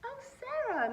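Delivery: very high, loud gushing greeting. Acted. Principal greeting new student, high gushing. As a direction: gush over her. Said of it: "Missus Burton gushed".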